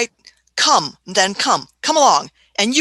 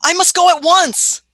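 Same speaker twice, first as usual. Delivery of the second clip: urgent; 8 semitones higher, 4 dB louder than usual. Acted. Doctor hears friend in distress.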